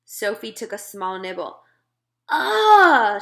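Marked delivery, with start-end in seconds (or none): 2.3-3.2 loud, high sputtering gasp; girl sputtering at a foul bite; sputtered ah. A narrator's reading. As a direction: choke on the taste. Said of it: "she sputtered".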